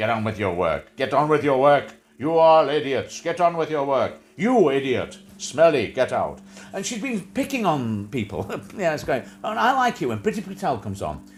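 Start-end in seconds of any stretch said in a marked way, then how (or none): 0.1-6.4 loud caricature bully's orders; Nazi stormtrooper impression. Host mimics Priti Patel bullying staff, barking impression. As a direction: bark insults and orders like a stormtrooper. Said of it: "a Nazi stormtrooper and goes".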